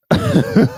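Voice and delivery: sudden high laugh; loud amused laugh; burst of laughter. Real, not acted. Laughs at own quote.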